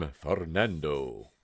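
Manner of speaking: deep, low suave lover voice; purring latin lover. Pretend. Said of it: "Ooh, that was a good voice".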